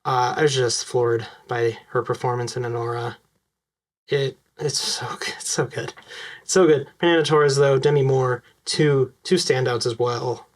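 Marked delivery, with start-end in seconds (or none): 4.1-5.9 hushed, breath-only praise; awed whisper. Real, not acted. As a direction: whisper it in real admiration.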